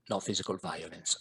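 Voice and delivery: dropped low and soft. Shown as a demonstration. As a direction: say it again, quietly.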